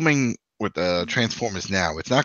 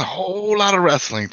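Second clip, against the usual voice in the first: hyped up; 9 semitones higher, 4 dB louder than usual. Pretend. Hyping the wrestling weekend.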